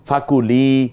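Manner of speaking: teacher stretching the vowel in ফাকুলি; ফাকুলি with long li. Shown as a demonstration. Quran teacher showing the student a long vowel. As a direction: draw out লি in ফাকুলি. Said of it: "লিতে টানলাম কেন?".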